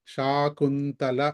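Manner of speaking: శాకుంతల drawn out very slowly; teacher reading an example word. Shown as a demonstration. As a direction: read శాకుంతల syllable by syllable. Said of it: "సులభంగా చదువుకోవడానికి".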